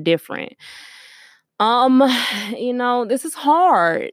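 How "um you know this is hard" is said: voice cracking. Real, not acted. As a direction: voice cracking, near tears. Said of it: "if my voice crack a little bit".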